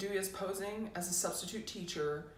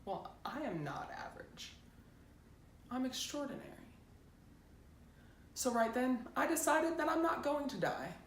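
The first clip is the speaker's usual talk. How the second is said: hushed start rising into higher-pitched resolve; defiant teenage patient; 6 semitones higher than usual. Acted. Teen decides she will not die, defiant. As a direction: start under your breath, then lift with determination.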